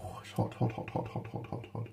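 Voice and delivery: hushed rapid whisper, handling something hot. Real, not acted. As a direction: whisper under your breath as your fingers burn.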